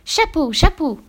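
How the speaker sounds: put-on high, loud insistent child voice; stubborn child. A narrator's reading. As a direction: insist on it like an obstinate little kid. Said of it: "s'obstina l'enfant". (enfant obstiné, voix aiguë)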